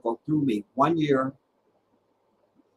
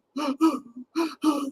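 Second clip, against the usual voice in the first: gasping sob; 14 semitones higher than usual. Retold or imitated. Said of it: "I'd be sitting there going".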